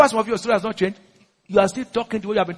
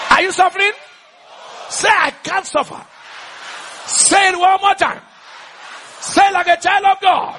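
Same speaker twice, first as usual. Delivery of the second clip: rousing shout; 9 semitones higher than usual. Real, not acted. Preacher rousing the church to declare they can't suffer, rousing shout. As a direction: shout it out, demanding an answer.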